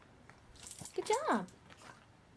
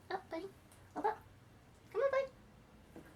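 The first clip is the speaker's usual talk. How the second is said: high coaxing; 9 semitones higher, 10 dB quieter than usual. Real, not acted. Coaxing dog up.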